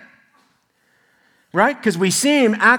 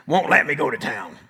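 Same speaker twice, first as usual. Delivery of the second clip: lightly voiced old cowboy; [name]; 3 semitones lower than usual. Cowboy friend on his wife keeping him home, lightly imitated cowboy. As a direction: imitate a complaining old cowboy, slightly low. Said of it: "He talks like this".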